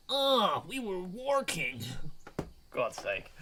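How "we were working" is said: "working" stretched out, high and slow; mock your own slip on "working"; drawn-out, put-on "working"; exaggerated slip. Real, not acted. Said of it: "What's wrong with me?".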